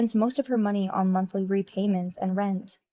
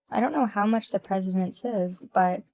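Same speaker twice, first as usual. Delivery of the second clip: ordinary delivery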